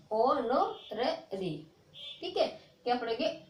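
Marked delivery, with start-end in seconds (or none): 0.1-1.5 ओ नो रे री said slowly, syllable by syllable, with no h sound; silent h honorary. Shown as a demonstration. Teacher slowly sounds out honorary for students. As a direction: spell out ओ नो रे री slowly without the h. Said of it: "तो कैसे पढ़ेंगे".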